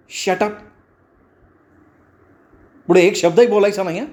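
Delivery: high-pitched, indignant telling-off; sharp rebuke, rushing into a warning; snapping rebuke. A narrator's reading. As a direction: scold him and shut him up. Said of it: "तिने त्याला झापलं सुद्धा".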